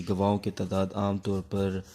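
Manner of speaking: ordinary talk, nothing marked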